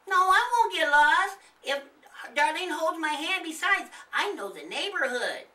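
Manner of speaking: put-on high, loud pleading kid; insistent little boy.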